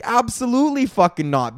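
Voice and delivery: ordinary talk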